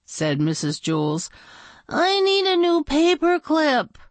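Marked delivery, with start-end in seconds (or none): 1.9-4.0 high schoolchild voice; pleading pupil. Acted. Dana asking her teacher for a paperclip, high pleading voice. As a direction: plead it, pitched high all through.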